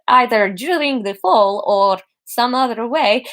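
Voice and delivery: slow Gru-style Russian doctor; Russian accent. Acted. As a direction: stretch it out in Russian.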